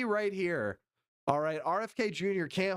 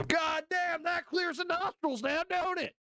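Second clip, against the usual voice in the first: hammed-up delighted sniffer, pitched way up; high mock relish; 12 semitones higher than usual. Man mocking a politician sniffing the air, high hammed-up delight. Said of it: "took a deep whiff and went".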